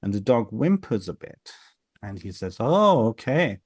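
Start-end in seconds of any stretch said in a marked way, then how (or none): 2.6-3.6 slow, higher, drawn-out "oh"; acted reaction, stretched and raised; knowing acknowledgement. Little Welsh guy as the dog whimpers, knowing acknowledgement.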